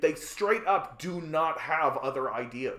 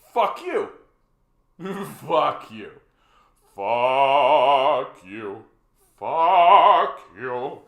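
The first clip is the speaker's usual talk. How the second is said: each fuck you louder, last ones yelled; a shouted fuck you growing ever louder; escalating shout; 6 semitones higher, 16 dB louder, much slower than usual. Real, not acted. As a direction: build to a full-throated yell. Escalating insult at landlords.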